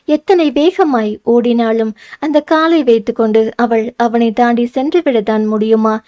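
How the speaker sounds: ordinary delivery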